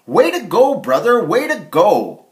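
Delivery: high, loud cheering. Acted. Harold and Herman cheer Larry for beating the wolf. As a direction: cheer your brother on.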